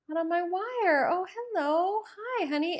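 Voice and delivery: high, sweet voice for a bird; talking to a bird. Real, not acted. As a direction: coo up high at the little bird. Greeting a bird outside.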